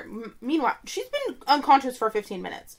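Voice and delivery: ordinary delivery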